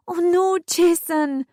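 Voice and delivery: high, distressed plea; high-pitched desperate begging. A narrator's reading. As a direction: beg him, close to tears. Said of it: "I begged, crying now".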